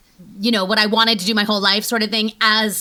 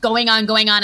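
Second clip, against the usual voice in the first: ordinary talk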